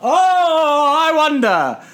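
high, drawn-out mock pondering; exaggerated high sing-out of sarcasm; sarcastic wondering. Real, not acted. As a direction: pretend to wonder, dripping sarcasm. Sarcastic reply whether they liked film.

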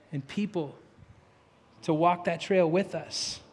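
prayer. Acted. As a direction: lead the church gently in prayer. Pastor leads closing prayer.

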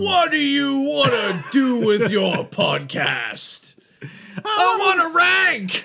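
high, loud mock chant; hammed-up shout. Cohost hyping the host's ranking habit with a mock shout. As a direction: yell it like a rallying cry, slowly.

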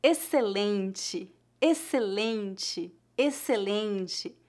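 "excelente" said very slowly, "xc" as a plain S and "te" as "tchi"; slow model word. Shown as a demonstration. Teacher to students learning Portuguese, slowly sounding out "excelente". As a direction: drill "excelente" three times, carefully. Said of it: "Como se pronuncia a palavra excellent em português?".

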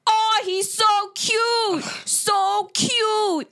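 high, loud, slow gushing squeal; mock the loud guy gushing over the cub; loud gushing man. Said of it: "this is what this guy was saying".

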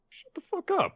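very high, soft falsetto; tiny high-pitched mock outburst; squeaky retort. Acted retort of Brooke in retelling.

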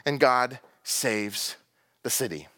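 "God saves" drawn out slowly, "the city" dropped low; slow emphatic point. Shown as a demonstration. Preacher landing his second point slowly. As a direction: lay "and God saves" out slowly, then sink. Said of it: "two things".

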